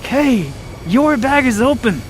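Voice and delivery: high imitation of a passing lady; imitated helpful lady. Lady telling him about his bag, high imitated voice. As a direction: pitch it up to mimic a lady alerting you. Lady's warning retold.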